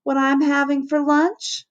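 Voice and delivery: put-on high, teasing kid voice; kid hollering. A narrator's reading. Kid calling out to the lunchroom, hollering. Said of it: "that kid might holler".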